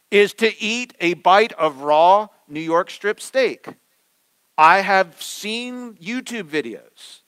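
loud, high, slow preaching build; sermon climax, slow and loud; preaching at full force. Acted. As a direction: drive the point home from the pulpit.

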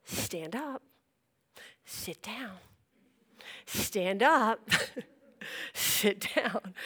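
hushed, short commands; mock covert cues to someone across a room; low-key retold instructions; discreet prompting. Preacher's hand signals, acted out.

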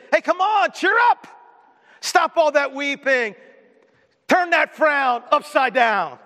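preacher mocking what Jesus did not say, loud and high; mock pushy cheerleader. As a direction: lampoon a friend who says cheer up. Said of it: "He didn't say that".

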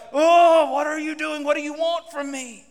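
loud, high exasperated cry; a fed-up child throwing up their hands. Pretend. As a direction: wail it like a fed-up kid.

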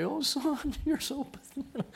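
high, laughing mock scorn; mocking God voice. God mocking the pathetic prophet, high and scornful. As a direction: sneer at your own son, laughing. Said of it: "I wanted to say".

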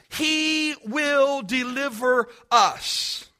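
slow, each word weighted; emphatic. Acted. The three Hebrew children answer the king emphatically. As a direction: lean hard on every word. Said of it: "said emphatically".

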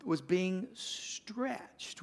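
drawn right out, each word stretched; very slow. Real, not acted. Preacher on Jesus in Gethsemane, stretched slow phrase. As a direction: stretch the words like the muscle.

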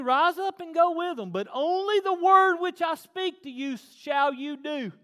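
high, loud scripture proclamation; forceful preaching. Acted. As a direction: build to full pulpit force.

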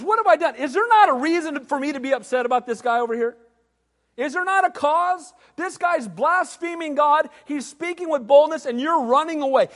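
David protesting the giant's blasphemy, fired up; indignant outcry. Acted. Heated indignant outcry.

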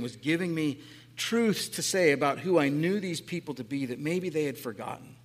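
ordinary talk, nothing marked

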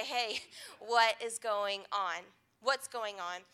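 low, measured, gentle question; slow right down and ask with concern; softening into a quiet, sincere question; caring check-in. Retold or imitated. Retold caring confrontation by mentors.

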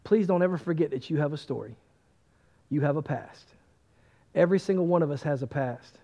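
soft, low pastoral appeal; gentle earnest plea. Real, not acted. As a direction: bring it down low and plead sincerely. Tender plea to the congregation.

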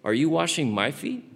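startled Peter asking his master; Peter's surprised question. Acted. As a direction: ask it like the shocked disciple. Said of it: "and Peter said to him".

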